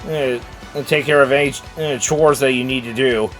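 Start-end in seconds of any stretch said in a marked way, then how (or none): none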